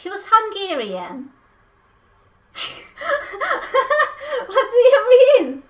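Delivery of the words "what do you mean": bursts out laughing, then asks loud through the laugh. Real, not acted. Streamer laughing at chat's mistake. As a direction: laugh it off in disbelief. Chat misheard boyfriend as British.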